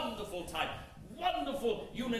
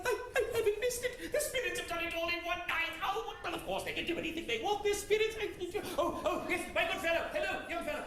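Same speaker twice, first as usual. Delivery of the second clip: put-on higher, excited old miser bubbling with glee; giddy old Scrooge; 8 semitones higher than usual. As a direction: play him as a delighted old man on Christmas morning. Performer voices Scrooge's joy.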